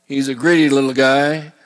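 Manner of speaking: ordinary talk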